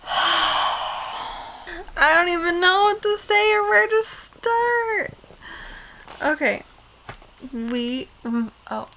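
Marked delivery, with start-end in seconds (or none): 0.1-5.1 nervous. Real, not acted. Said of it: "Under pressure".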